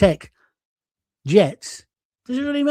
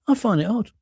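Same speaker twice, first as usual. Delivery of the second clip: ordinary delivery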